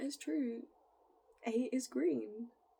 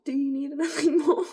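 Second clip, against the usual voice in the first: laughing through the question, much louder; 5 semitones higher, 12 dB louder than usual. Real, not acted. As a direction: burst into laughter mid-question. Joking about chips and gravy.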